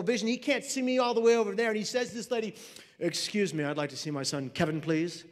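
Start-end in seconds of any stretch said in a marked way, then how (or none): 3.0-5.2 low, formal politeness; very proper. Acted. Father asks front-desk lady for his son, very properly. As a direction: speak lower, prim and courteous. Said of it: "Very proper".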